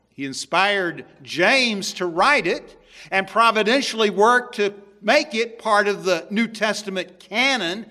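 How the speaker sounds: loud, building pulpit proclamation; forceful preaching. Acted. Preacher exalting scripture to his congregation, loud. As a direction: thunder out the list of what scripture is.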